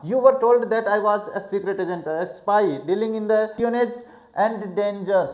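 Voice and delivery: slow, deliberate reading of the text aloud; careful classroom read-aloud; slow English reading. A narrator's reading. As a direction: read each English phrase slowly for the class. Slow read-aloud of novel passage.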